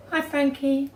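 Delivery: usual speaking voice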